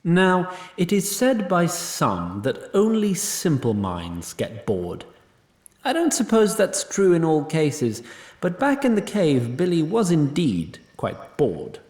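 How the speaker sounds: usual speaking voice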